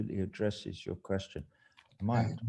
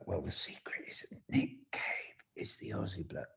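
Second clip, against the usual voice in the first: whispered secret; 3 semitones lower, 9 dB quieter than usual. Real, not acted. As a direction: whisper it as a confidential aside. Confiding a secret before the reading.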